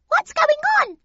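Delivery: loud, very high mouse squeak; high-pitched squeaky question. A narrator's reading. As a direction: pipe up like a tiny mouse. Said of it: "squeaked one of the other mice".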